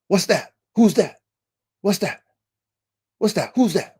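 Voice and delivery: high-pitched yips in words; translated dog bark. Retold or imitated. Showing the frequency of the night barking, imitated bark. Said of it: "The frequency was like a".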